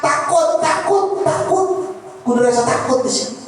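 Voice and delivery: high, insistent sermon voice; insistent preaching. Acted. Preacher urging all to recite salawat out of fear, insistent preaching. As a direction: punch each repeated "takut" high.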